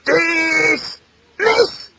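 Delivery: high, drawn-out command; military order. Acted. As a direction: order them off like a drill sergeant. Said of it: "he gave the order".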